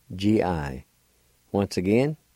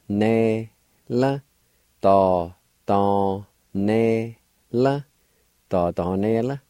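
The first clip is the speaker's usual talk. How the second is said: ordinary talk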